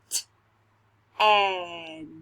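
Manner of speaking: T and and said apart; sounding out words. Shown as a demonstration. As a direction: sound out each piece. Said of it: "Listen to these".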